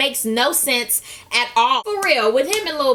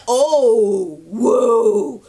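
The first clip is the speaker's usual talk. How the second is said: louder, rising alarmed whoa; loud mock whoa; 5 dB louder, much slower than usual. Recapper reacting to the rich man's outburst, loud and startled. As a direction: act startled and back off.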